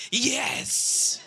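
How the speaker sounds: happy shout through a laugh; laughing mock-thrilled yes; excited yes. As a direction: cheer it like a gift you wanted. Dad thrilled about underwear.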